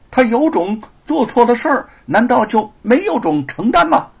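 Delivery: loud, high sneering retort; mocking. Constable mocking the scholar who interfered, loud sneer. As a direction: throw it back with scorn. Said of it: "眼中包含着嘲讽".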